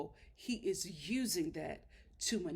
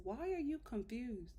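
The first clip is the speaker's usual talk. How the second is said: ordinary delivery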